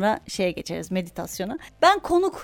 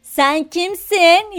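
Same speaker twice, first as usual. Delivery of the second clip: put-on Romani accent; 6 semitones higher, 6 dB louder, much slower than usual. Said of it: "roman şivesiyle falan konuşuyor".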